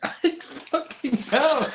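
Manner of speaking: laughing out the words; giggling through it. Real, not acted.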